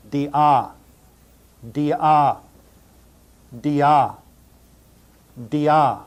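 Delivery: "dia" said dee-AH, firm on the final vowel; pronunciation demo. Shown as a demonstration. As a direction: model "dia" slowly for learners as dee-AH. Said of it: "and the word is pronounced".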